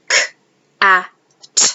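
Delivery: isolated phonemes k, a, t; segmented sounds. Shown as a demonstration. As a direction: say each sound of cat apart: k, a, t. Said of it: "if I were to segment the word cat".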